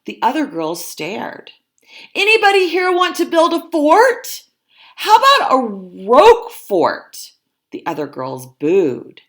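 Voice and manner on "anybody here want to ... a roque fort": high, loud, bursting with an idea; rally the others, loud and high; eager pitch. Acted. Dolores rouses the girls.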